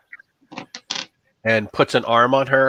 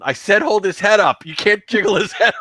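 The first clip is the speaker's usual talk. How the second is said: mild scolding; 7 semitones higher than usual. Acted. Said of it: "cursing at him in Latin".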